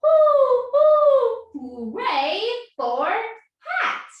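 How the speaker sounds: high loud hoo hoo into a slow hooray; owl cheering. Acted. Owl who got a hat, cheering high and loud. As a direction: hoot loud and high, then stretch the cheer. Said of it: "Owl smiled and cheered".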